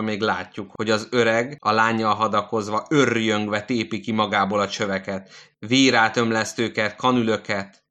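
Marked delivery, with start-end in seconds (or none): none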